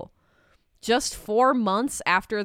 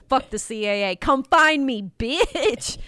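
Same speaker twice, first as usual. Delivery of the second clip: defiant; 4 semitones higher, 4 dB louder than usual. Retold or imitated. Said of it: "Padvant Guard says".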